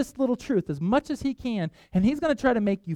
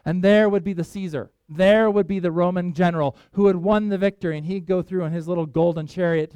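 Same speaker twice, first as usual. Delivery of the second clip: ordinary talk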